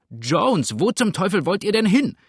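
loud, high-pitched angry call; shouting after someone. A narrator's reading. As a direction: yell after the man running away. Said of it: "rief er hinter dem Davonspringenden her".

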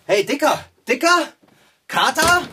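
high, loud calls across the room; calling out loud. Acted. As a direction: shout to get his attention. Calling to friend wearing earplugs. (laut rufen, hoch und laut)

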